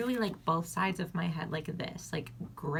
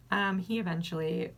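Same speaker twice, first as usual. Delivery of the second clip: ordinary talk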